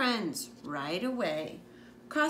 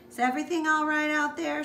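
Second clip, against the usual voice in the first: louder, faster call from the window; 6 semitones higher, 5 dB louder, faster than usual. Acted. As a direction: call out to the kids outside. Mom calls from the window.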